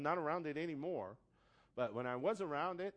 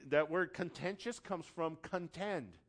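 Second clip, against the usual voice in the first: ordinary talk, nothing marked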